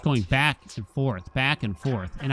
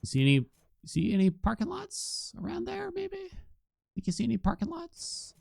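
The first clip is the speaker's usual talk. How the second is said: high, softer mock-innocent question; teasing; 8 dB quieter than usual. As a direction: needle your wife about the lost lot. Teasing wife about old story.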